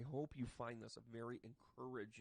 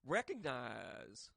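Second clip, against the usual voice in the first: sassy drawn-out; 3 dB louder, much slower than usual. Acted. Drawn out slang sign-off.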